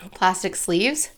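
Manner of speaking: ordinary talk, nothing marked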